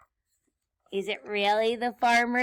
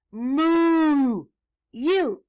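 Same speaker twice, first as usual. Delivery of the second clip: loud, raised, drawn-out hail; shouted call; 4 semitones higher, 10 dB louder than usual. Acted. Witch calls out to the cow, loud hail. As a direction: holler it with a long vowel. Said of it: "she shouted".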